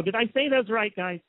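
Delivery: ordinary talk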